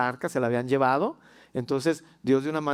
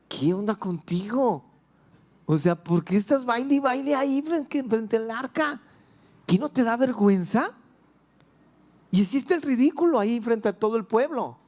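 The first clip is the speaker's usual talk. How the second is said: higher, scolding wife's voice; reproaching wife; 7 semitones higher than usual. Pretend. Michal confronting David in private, scolding. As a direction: scold him like an embarrassed wife.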